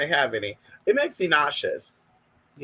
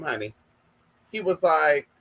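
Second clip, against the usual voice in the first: ordinary delivery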